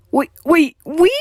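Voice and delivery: high frightened yelp; a scared squeak of alarm. Acted. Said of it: "Garashi yelped".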